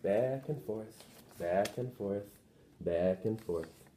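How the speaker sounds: slow hushed lullaby lilt; gentle rocking. A narrator's reading. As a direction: lull the baby to sleep. Mother rocks the baby.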